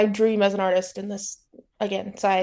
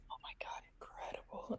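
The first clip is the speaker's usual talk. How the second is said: breathy stunned whisper; hushed, delighted whisper of amazement; whispered awe; 27 dB quieter than usual. Real, not acted. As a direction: whisper it like you can't believe it. Awed reaction to fingerless gloves.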